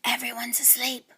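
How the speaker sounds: soft breathy whisper. A narrator's reading. Grandpa calling the twins out of the hole, whispering. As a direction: call them out in a hush. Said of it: "he whispered".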